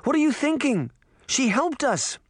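very high, protesting outcry; dismayed cry. A narrator's reading. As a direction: cry out in disbelief. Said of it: "Sabrina cried".

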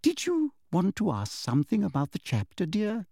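lightly voiced prim teacher, falsely kind; sweet teacher voice. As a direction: talk down to her with syrupy politeness.

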